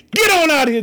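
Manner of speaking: sudden loud, high burst; acted shout to send someone packing; dismissive shout. Blind man rebuking his accusers, as preacher retells it, loud dismissal.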